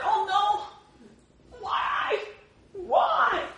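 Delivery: put-on high wailing child's cry, shouting; kid screaming bloody murder. Preacher mimics a child denied by a parent, high wailing shout. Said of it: "the kid screams bloody murder".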